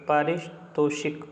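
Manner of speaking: पारितोषिक slowly, split before तोषिक; slow syllable breakdown. Shown as a demonstration. Teacher checks the spelling for students, sounding out slowly. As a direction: break पारितोषिक into parts, falling at the end. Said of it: "ये दोनों ठीक है".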